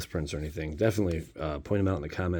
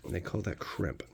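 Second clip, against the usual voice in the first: "They call that crimp." repeated much softer, like a side note; softer aside; 9 dB quieter than usual. Shown as a demonstration. Explaining card terms to viewers, a quiet echoed aside. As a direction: say "crimp" again quietly to yourself.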